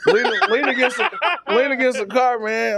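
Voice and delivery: laughing. Retold or imitated. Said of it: "He was like".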